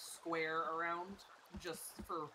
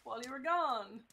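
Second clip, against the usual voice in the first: excited friend; 8 semitones higher, 5 dB louder than usual. Pretend. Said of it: "it's like".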